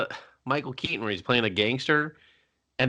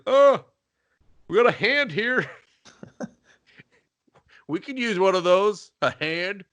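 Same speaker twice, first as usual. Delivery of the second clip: high goofy wonder; dopey high voice; 7 semitones higher, 4 dB louder than usual. Retold or imitated. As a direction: sound dim and amazed. Dim guards admiring a hand.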